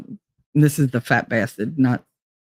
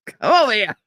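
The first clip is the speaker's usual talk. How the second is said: Foghorn Leghorn; 6 semitones higher, faster than usual. Retold or imitated. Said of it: "Foghorn Leghorn".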